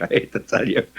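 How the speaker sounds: breaking into a high giggle mid-line; laughing. Real, not acted. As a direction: laugh through the words at the joke. Laughs at his own joke.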